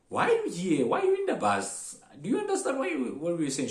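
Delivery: high, loud mock interrogation; incredulous questioning. Conductor keeps questioning the confused foreigner.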